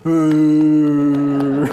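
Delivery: long, loud, held "ooh"; sustained mock crowd "ooh"; loud drawn-out ooh. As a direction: hold a loud whoop on one note. Acts own noisy reaction to proposal.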